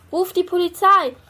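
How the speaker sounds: high, loud call for help; a panicked girl screaming for police; screamed. A narrator's reading. Fanny in a towel screams to parents, high and loud. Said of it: "schrie Fanny".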